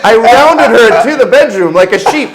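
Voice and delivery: loud high laughter through words; can barely speak for laughing; laughing hard. Real, not acted. Telling the sheep punchline, laughing loud and high. Cracking up at story.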